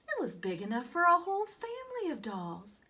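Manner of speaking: ordinary delivery, nothing marked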